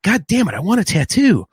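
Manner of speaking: high-pitched exasperated outburst, acted out; mock frustrated cry, swooping high; retold frustration. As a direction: act out getting mad, pitch jumping up. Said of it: "I'm getting mad".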